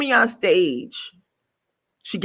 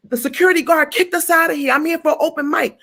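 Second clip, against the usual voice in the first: indignant retold complaint; 8 semitones higher than usual. Her own retold complaint, high.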